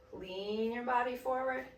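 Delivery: ordinary talk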